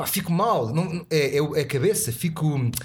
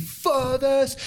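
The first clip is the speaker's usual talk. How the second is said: mock exclamation; 9 semitones higher than usual. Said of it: "tiro e digo".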